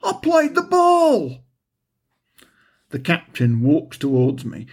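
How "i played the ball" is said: much higher, slow outraged cry; indignant protest. Acted. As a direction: protest in disbelief at the call. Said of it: "he can't believe it".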